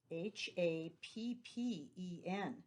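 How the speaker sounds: slow, letter-by-letter spelling of "H A P P E N"; teacher spelling the word happen for pupils, slowly and evenly; spelled letter by letter. Shown as a demonstration. Reading each letter apart. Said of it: "The next word is happen".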